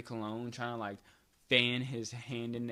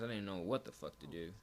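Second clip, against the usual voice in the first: usual speaking voice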